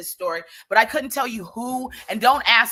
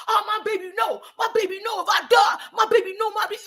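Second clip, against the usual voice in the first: high, loud hammed-up wail; mock wailing; 11 semitones higher, 3 dB louder than usual. Mocking emotional friends, hammed-up high wail. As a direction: overdramatic mock sobbing for baby.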